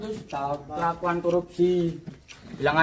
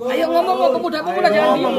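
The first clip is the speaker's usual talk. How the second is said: loud, very high-pitched goading; shouted urging; 10 semitones higher, 8 dB louder than usual. Real, not acted. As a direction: rouse the silent crowd to speak up. Urging silent youths to speak up. (seruan lantang mendesak dengan nada tinggi)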